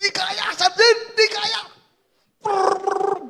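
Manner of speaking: high, slow pleading refusal; panicked soldiers. Retold or imitated.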